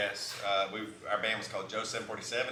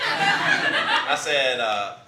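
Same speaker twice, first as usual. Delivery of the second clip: ordinary talk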